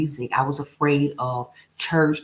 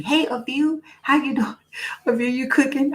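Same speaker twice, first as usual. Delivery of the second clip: high, happy hello with a laugh; host greeting a viewer, high and happy; bright greeting; 10 semitones higher than usual. Real, not acted.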